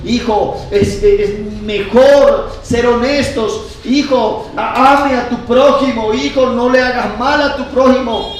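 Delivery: loud, high fatherly exhortation; full-force preaching. Acted. Preacher models what parents should tell children, forceful exhortation. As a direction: preach it as urgent advice to a son.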